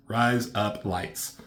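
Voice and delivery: slow, word-by-word trial; deliberate, drawn-out attempt at the phrase; sounding it out. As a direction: test each word of "Rise up lights" slowly.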